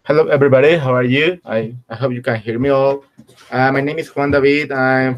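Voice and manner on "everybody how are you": usual speaking voice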